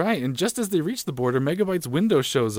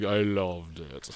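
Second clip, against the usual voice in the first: low, drawn-out gloating villain; relishing villain impression; 8 semitones lower, 7 dB quieter, much slower than usual. Cohost quoting the villain's favourite line, relishing it. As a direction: savour it slowly, low and soft. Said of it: "I love the way he said it".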